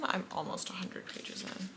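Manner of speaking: muttered under the breath; a quiet mutter while reading. Real, not acted. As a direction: talk to yourself, barely voiced.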